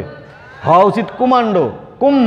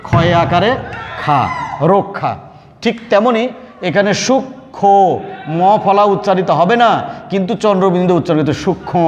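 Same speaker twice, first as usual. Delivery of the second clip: usual speaking voice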